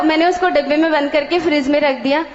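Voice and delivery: usual speaking voice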